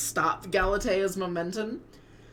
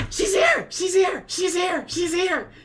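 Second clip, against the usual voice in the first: much higher and louder, bursting with excitement; giddy excited squeal; 13 semitones higher, 6 dB louder than usual. Acted. Familiar Typhon announces the arrival to Lysandra with giddy excited squeals. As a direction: squeal it out like an overjoyed little creature. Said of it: "very giddy and excited".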